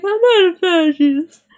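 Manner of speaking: high, loud, wailing whine; mock crying whine. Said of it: "And then Barbie cries".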